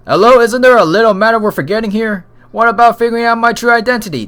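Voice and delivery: high, loud, protesting character voice; indignant talking dog. Acted. Dog reminds them of its forgotten case, indignant.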